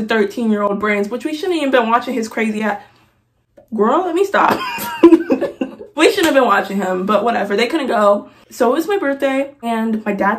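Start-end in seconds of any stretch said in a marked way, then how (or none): 4.6-5.9 high laugh. Real, not acted. Vlogger cracking up at her own joke, high giggle.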